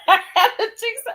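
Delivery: high laughing read-out, cracking up; laughing while reading. Retold or imitated. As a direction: giggle through the quote. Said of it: "Britt said".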